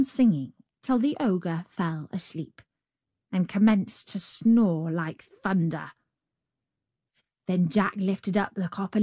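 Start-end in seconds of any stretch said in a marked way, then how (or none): none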